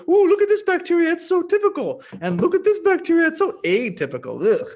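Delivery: high, loud mocking snob; snooty judgy voice. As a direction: sneer at the bacteria like a snob. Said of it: "Where you're like".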